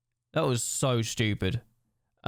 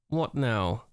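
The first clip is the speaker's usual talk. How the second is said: normal talking voice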